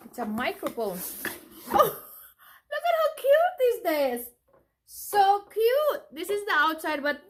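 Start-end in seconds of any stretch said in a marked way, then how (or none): 1.7-6.0 high, loud cooing over something cute; cute squeal. Real, not acted. Mother finds a little bag in the gift, squealing high and loud.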